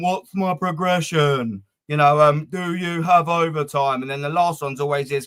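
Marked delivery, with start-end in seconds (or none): 0.0-1.6 slow put-on job-candidate voice; mock-polite slow question to the boss; interviewer question. 2.5-4.0 slow put-on job-candidate voice; mock-polite slow question to the boss; interviewer question.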